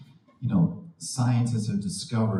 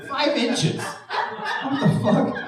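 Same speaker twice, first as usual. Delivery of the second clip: shocked; 14 semitones higher, much slower than usual. Retold or imitated. Shocked at ear hair.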